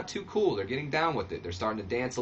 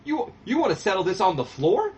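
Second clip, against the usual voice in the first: much higher, louder mock-indignant wrestler voice; Disco taken aback; 8 semitones higher, 3 dB louder than usual. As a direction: act offended, then accept the fight. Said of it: "he goes".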